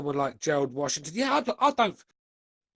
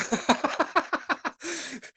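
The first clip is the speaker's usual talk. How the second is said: high laugh. Real, not acted. Laughs at own joke.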